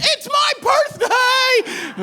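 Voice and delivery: put-on high, slow shrieking drunk girl; drunk party yell. Drunk birthday girl again.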